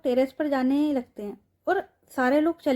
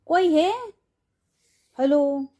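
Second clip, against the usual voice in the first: mock calling out; 4 semitones higher, 4 dB louder, slower than usual. Mahira mockingly calls for help.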